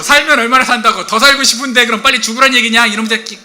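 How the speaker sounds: loud, high indignant rant; acted fury. Offended father-in-law hitting back at his son-in-law, furious. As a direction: fire back, outraged, at full volume. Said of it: "열이 받아버린 거예요".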